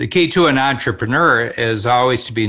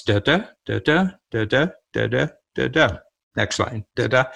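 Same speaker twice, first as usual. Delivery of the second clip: da-DA beats tapped out evenly, like counting meter; sing-song da-da meter, lightly marked; iambic rhythm demo. Shown as a demonstration. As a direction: chant the da-da beats to show the verse rhythm. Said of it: "And it goes".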